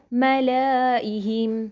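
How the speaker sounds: "ملائہم" slow with a long middle a; drawn-out reading. Shown as a demonstration. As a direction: stretch the middle vowel of "ملائہم". Modelling the written word.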